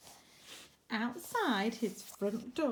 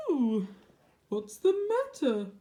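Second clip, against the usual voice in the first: concerned giant; 2 semitones higher, 5 dB louder than usual. Acted. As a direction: lean in with concern. Giant asks howling dog.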